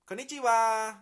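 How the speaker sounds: Konnichiwa with the final "wa" drawn up high. Shown as a demonstration. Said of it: "take that last vowel and they bring it up".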